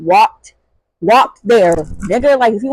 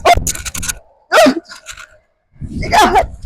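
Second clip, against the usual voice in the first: high squealed oh, then a laugh; shocked yelp. Retold or imitated. Reenacting being sprayed, a high shocked gasp. Just got pepper sprayed.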